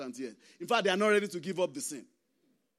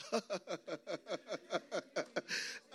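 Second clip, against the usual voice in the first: long, rattling string of ha-ha-ha; mocking laugh; 10 dB quieter than usual. Real, not acted. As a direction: chuckle on and on at the pious act. Laughs after mocking holy singing.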